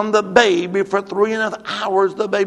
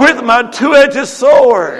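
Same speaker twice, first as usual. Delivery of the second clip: loud, high, slow call-out; rousing declaration; 6 semitones higher, 13 dB louder, much slower than usual. Real, not acted. Preacher leading the church to repeat after him, rousing declaration. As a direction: proclaim it slowly for the crowd to echo.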